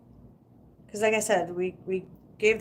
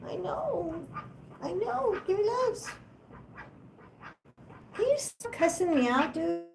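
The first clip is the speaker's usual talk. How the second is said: high, cooing voice for a pet; 10 semitones higher, 4 dB quieter than usual. Real, not acted. Talking baby-talk to her duck.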